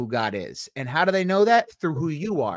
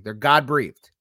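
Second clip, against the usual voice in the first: ordinary delivery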